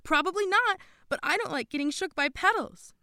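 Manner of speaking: loud, high, insistent; Madeline insisting. A narrator's reading. Girl overruling doubts about the soundboard, insistent. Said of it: "Madeline insisted".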